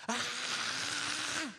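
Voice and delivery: high mock scream of the wife. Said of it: "but, like, a real scream".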